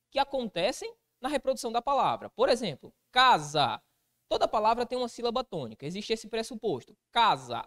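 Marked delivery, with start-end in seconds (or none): 3.1-3.8 "casa" with a loud, drawn first syllable, falling away; stressed first syllable. Shown as a demonstration. Teacher giving an example of tonic syllable, stress on the start of "casa". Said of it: "você profere com mais ênfase". 7.1-7.6 "Casa" hit firmly on the first syllable, low falling end; stressed first syllable. Shown as a demonstration. Teacher showing where the tonic syllable falls, "Casa" stressed at the start. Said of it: "A sílaba tônica de casa é o K".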